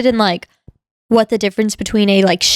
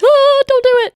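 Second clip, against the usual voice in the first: high, loud gasp of warning; alarmed cry; 5 dB louder than usual. Retold or imitated. Listener warning the child in the story, alarmed cry. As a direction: cry out as if to stop someone.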